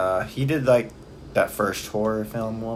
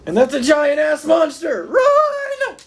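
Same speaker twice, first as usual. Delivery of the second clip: high panicked shout; 8 dB louder, much slower than usual. Pretend. Surreal exit bit, monster alarm.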